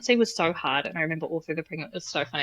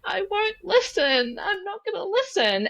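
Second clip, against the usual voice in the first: much higher, slow wailing refusal, half mock; tearful refusal; 13 semitones higher, slower than usual. Crying to her husband that she won't listen, high mock wail. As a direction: play up the crying stubbornness. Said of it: "tears streaming down my face".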